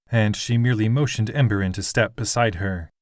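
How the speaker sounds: ordinary delivery, nothing marked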